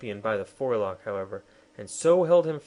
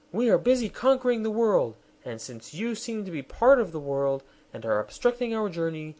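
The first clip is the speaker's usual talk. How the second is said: high, lofty royal voice; a queen lecturing her captives; haughty queen; 5 semitones higher than usual. Acted. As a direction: speak down to them from the throne.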